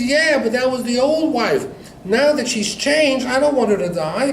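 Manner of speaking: high, loud storyteller's plea; impassioned protest. Retold or imitated. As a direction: play the desperate husband, building to a punchline. Husband protests his wife is dying.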